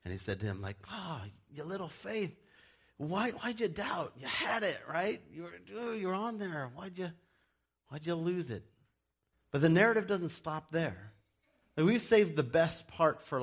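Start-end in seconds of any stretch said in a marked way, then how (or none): 0.9-8.6 tender tease to a friend who slipped; gentle chiding. Pretend. Jesus gently chides Peter.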